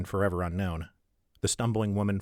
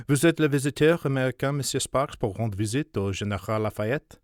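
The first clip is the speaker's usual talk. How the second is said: rapid French footman, louder and higher; very fast French; 5 semitones higher, 3 dB louder than usual. Acted. Footman questioning the visitor, very fast French. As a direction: rattle off the French at speed. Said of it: "replies in very fast, untranslated French".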